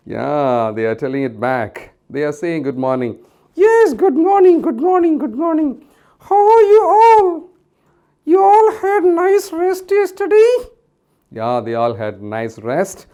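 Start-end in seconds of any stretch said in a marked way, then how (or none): 3.6-10.5 Mickey puppet voice. Acted. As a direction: greet the kids like a bouncy puppet. Puppet Mickey greets the children.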